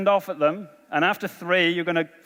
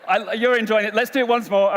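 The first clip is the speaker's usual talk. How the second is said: usual speaking voice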